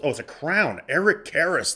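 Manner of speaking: normal talking voice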